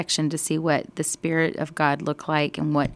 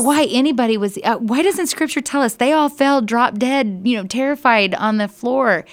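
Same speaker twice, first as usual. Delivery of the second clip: higher and louder, words tumbling out fast; excited amazement; 5 semitones higher, 4 dB louder than usual. Real, not acted. Teacher amazed the crowd didn't fall down, talking high, loud and quick. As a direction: marvel out loud, rushing with wonder. Teacher marvels at God speaking.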